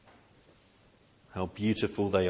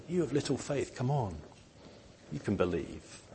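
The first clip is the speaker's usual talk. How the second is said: softly coaxing, gentle reassurance; a kind teacher nudging a doubter; tender; 3 semitones higher, 9 dB quieter than usual. Pretend. Jesus gently encouraging his disciples, soft and tender. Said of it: "saying that very tenderly".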